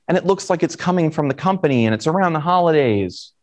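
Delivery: normal talking voice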